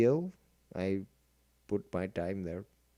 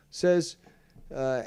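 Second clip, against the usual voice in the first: ordinary delivery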